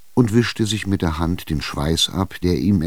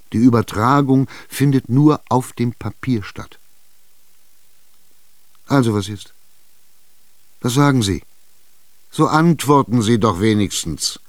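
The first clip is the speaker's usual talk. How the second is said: higher, louder, impatient buyer; Chichikov insisting; 5 semitones higher, 4 dB louder than usual. Acted. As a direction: corner her, push for an answer. Tschitschikow drängt die Alte. (Tschitschikow drängt ungeduldig, höher und lauter)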